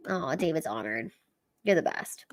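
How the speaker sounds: ordinary delivery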